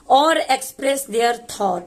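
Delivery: very slow, word-by-word reading; slow demonstration. Shown as a demonstration. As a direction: read the phrase out slowly for learners. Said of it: "मैं धीरे धीरे करके बताऊंगी".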